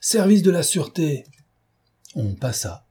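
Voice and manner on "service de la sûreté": high-pitched official shout; crying out from a car; called out. A narrator's reading. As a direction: announce yourself to the gate in a raised voice. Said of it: "Et il cria devant l'octroi".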